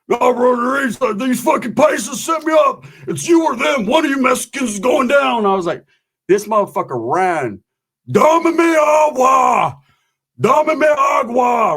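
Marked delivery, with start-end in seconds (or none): 0.1-5.4 furious shout. Retold or imitated. Roach furious after spicy taco. 8.1-11.8 very high, slow, loud plea for water; desperate shout. Retold or imitated. Roach begging for water.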